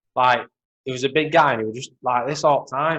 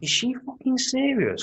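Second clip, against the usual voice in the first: high incredulous mock outrage; disbelief; 10 semitones higher than usual. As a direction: sneer it in disbelief. Retelling disbelief at woman on plane.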